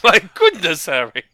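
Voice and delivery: high, loud wizard voice; Dumbledore impression. Co-host shows how Dumbledore sounds, high and loud. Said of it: "That's what Dumbledore sounds like".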